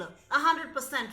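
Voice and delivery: usual speaking voice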